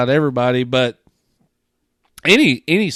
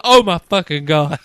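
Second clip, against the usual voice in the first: Emma's line laughed out, a bit louder; laughing disbelief; 4 semitones higher, 4 dB louder than usual. Retold or imitated. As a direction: gasp it through a laugh. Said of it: "She's like".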